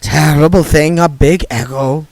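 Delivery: a hint of gossipy old witch; Nanny Ogg's voice.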